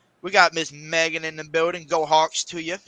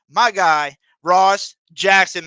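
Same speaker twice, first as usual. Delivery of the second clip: drawn out slowly and louder; hype introduction; 3 semitones higher, 8 dB louder, much slower than usual. Acted. Host announcing his guest on the podcast, slow hype call. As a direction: stretch the name out like a ring announcer.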